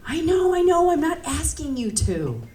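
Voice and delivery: high-pitched, hurried reassurance; high placating voice. Acted. Customer calming the flustered clerk, high placating tone. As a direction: back off quickly, voice way up.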